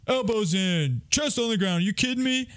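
high-pitched mock drill instructor; drill-sergeant commands, strained up high; military dad. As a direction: imitate a military father ordering push-ups, pitch climbing. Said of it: "he was in the military".

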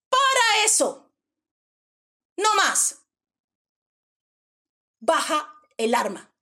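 loud, drawn-out order to halt; commanding shout. Acted. Woman ordering an armed attacker to stop, commanding shout. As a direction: shout it down with full authority.

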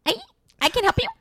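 high, loud, chirpy phone voice; sweet receptionist greeting a caller; customer service voice. Shown as a demonstration. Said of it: "Can you take me higher?".

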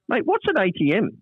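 high, naive imitated voice; baffled Longy. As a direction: ask it completely innocent. Longy baffled by cash machine.

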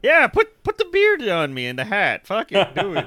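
high, loud mock enthusiasm; eager agreement. Host pretends to accept pirate cameo, excited and insistent. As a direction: jump in, totally game for it.

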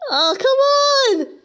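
high, slow, wailing groan; frustrated wail. Real, not acted. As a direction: wail at the frozen game. Game stuck in loop.

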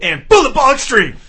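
loud, high, drawn-out hype call; hyped catchphrase shout. Acted. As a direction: belt it like a show catchphrase.

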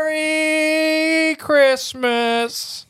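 high, very slow Merry Christmas; drawn-out kid greeting. Acted. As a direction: stretch it out like a happy kid.

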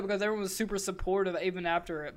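ordinary talk, nothing marked

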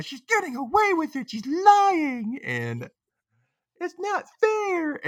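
high-pitched mock whining; mock complaining. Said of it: "he's, like, complaining to Emma".

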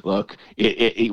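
ordinary talk, nothing marked

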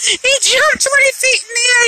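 high, giddy retelling; amused excitement. Real, not acted. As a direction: tell it still giddy from laughing. Laughing retelling of cat's leap.

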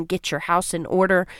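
ordinary talk, nothing marked

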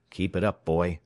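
usual speaking voice

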